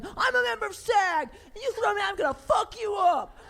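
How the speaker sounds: high, loud hammed-up rant; threatening the first AD, hammed up; mock ranting threat. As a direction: rant like a furious extra. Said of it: "I'm still ranting and raving".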